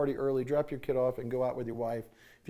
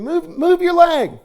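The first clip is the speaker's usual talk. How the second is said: commanding; 12 semitones higher, 9 dB louder, slower than usual. Retold or imitated. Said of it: "I said".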